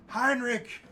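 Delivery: loud, high battle taunt; screamed challenge. Acted. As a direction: scream a challenge at a hidden enemy. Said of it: "scream out again".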